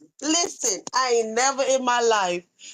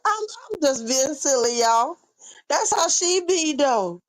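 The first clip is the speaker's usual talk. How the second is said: ordinary talk, nothing marked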